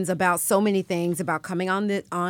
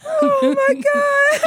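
high shrieking laughter on the words; cracking up; slower than usual. Real, not acted. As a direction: lose it laughing.

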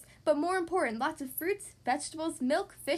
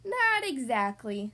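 slow, dry doctor; 2 semitones lower, slower than usual. Acted. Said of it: "said the doctor".

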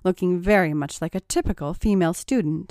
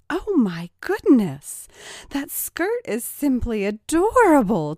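gushing mock delight, high and loud; teasing; 6 semitones higher, 5 dB louder than usual. A narrator's reading. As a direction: coo over it teasingly. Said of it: "teased Rachel".

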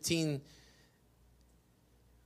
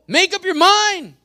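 high, loud burst of command; exasperated shout; 13 semitones higher, 14 dB louder than usual. Real, not acted. Preacher to waverers who won't commit, shouting. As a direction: cut off the dithering with a yell.

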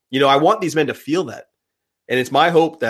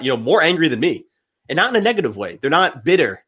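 ordinary talk, nothing marked

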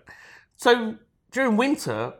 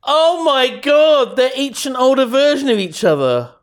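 gasping disbelief, much louder and higher; surprised; 5 semitones higher, 6 dB louder than usual. Real, not acted. Shocked at the photo.

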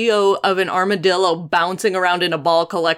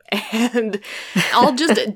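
ordinary talk

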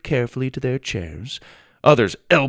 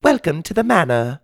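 put-on higher-pitched British boy voice; pleasant British accent; 8 semitones higher, 3 dB louder than usual. A narrator's reading. Well-dressed boy welcomes the newcomer, pleasant British accent. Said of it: "He spoke in a pleasant British accent".

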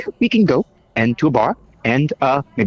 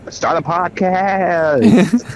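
slow drawl; 6 semitones higher, much slower than usual. Acted. Drawn-out joke line.

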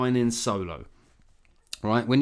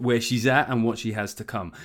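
ordinary talk, nothing marked

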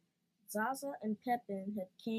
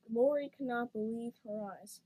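plain reading voice

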